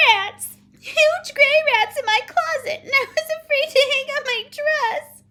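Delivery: very high, loud alarm; frightened little girl. Acted. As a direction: cry it out in childish panic. Child reports rats in fright.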